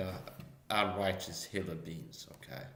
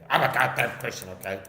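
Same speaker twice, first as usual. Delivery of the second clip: Poon; 3 semitones higher, 9 dB louder than usual. Retold or imitated. Comic showing how a rival starts on him, loud and mocking. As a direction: burst in with exaggerated loud mimicry. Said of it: "Poon like this".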